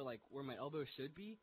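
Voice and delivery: ordinary delivery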